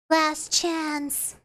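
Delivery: ordinary delivery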